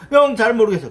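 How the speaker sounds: high, loud helpless whine; whiny junior. Retold or imitated. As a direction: plead like a clueless junior. Junior whining to senior.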